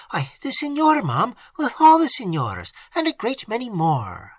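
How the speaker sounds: high, hurried servant voice; flustered maid. A narrator's reading. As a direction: tell her lady everything at once. Maid explains who fights.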